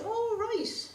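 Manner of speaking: high-pitched put-on surprise; surprised. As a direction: feign mild surprise, high. Nora reacting to the slug pellets.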